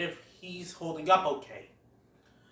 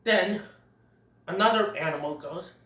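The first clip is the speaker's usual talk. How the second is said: normal talking voice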